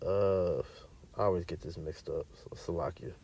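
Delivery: searching aside. Real, not acted. Preacher asks listeners to bear with him while finding a verse, very quiet low aside. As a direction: talk quietly to yourself while hunting for the place.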